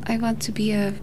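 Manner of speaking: ordinary talk, nothing marked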